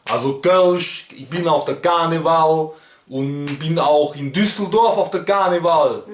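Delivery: imitated Cologne accent; Kölsch dialect. Shown as a demonstration. YouTuber frets about his camera, in Kölsch dialect. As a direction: put on broad Rhineland Kölsch. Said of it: "Ich kann noch Kölsch sprechen".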